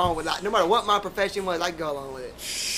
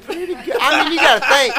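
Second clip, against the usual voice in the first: chuckling through the words, higher and much louder; laughing; 9 semitones higher, 10 dB louder than usual. Real, not acted. Bragging to the host about free Hooters food, laughing. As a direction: laugh it out as you brag.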